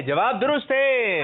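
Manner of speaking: high, loud, drawn-out proclamation; triumphant announcement. Real, not acted. Host announces the child's correct answer, triumphant. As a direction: sing out the winning verdict, stretched and high.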